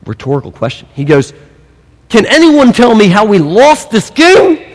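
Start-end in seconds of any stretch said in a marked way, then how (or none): 2.1-4.6 loud, raised demanding coach voice; angry coach. Retold or imitated. Coach to his beaten college team, demanding and loud. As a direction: demand answers like a fuming coach. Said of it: "the coach, of, of course, he goes".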